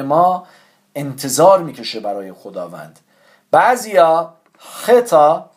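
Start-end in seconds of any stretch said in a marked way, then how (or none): 4.6-5.5 "خطا" slow, with a strong throaty kh; letter name demo. Shown as a demonstration. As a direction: draw out "خطا", rasping the first consonant.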